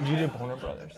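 hushed, near whisper. Real, not acted. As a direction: drop it to a hushed question. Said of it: "Why'd you whisper it?".

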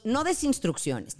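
normal talking voice

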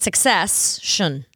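"succession" drawn out slowly as success-shun; slow, split-up succession, success... shun; success plus shun. Shown as a demonstration. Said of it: "Like success".

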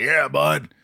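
high, friendly wrestler voice; imitating a friendly wrestler; imitating Rowdy Roddy Piper. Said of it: "He's like".

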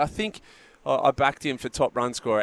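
ordinary talk

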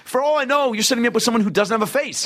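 high, fast, anxious rambling; high mock worry. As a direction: rattle it off up high. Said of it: "I was like".

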